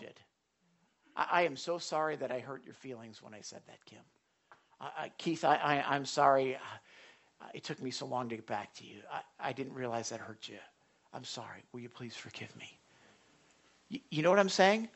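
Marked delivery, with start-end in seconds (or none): 1.1-10.6 soft, humble, halting apology; quiet heartfelt sorry; sincere apology. Acted. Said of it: "You can acknowledge that hurt". 11.1-12.8 much softer, humble "I'm sorry"; mean every word, barely above a hush; tender heartfelt plea; sincere apology. Acted. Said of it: "You can acknowledge that hurt".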